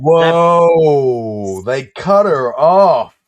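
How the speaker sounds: loud, slow astonished whoa; loud disbelief; surprised. Real, not acted. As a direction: react big to the interruption. Surprised at the cut.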